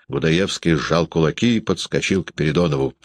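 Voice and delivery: ordinary delivery, nothing marked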